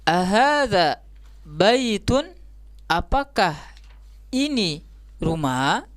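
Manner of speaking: "Ahadza baitun" slow, lifting on "baitun", then "rumah" rising; question intonation. Shown as a demonstration. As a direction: ask "Ahadza baitun, apakah ini rumah" deliberately, in separate chunks. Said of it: "itu kan istifhamiyah". (intonasi bertanya, "baitun" dan "rumah" naik)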